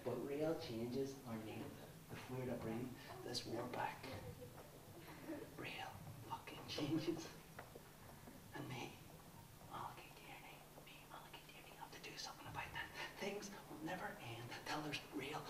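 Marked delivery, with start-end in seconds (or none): 2.1-8.9 menacing whisper. Acted. Menacing terrorist monologue, whispered. 9.7-12.9 breathy whispered self-persuasion; obsessive whisper. Acted.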